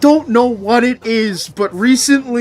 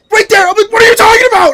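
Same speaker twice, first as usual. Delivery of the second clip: much higher, louder mock yell; incredulous shout; 10 dB louder, much faster than usual. Streamer mocking people who say like, much higher loud shout. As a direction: yell it in disbelief at them.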